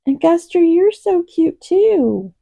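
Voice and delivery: high, slow cooing to a pet; kitty voice. Real, not acted. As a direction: coo at the cat in a high kitty voice. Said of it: "Sorry for my kitty voice".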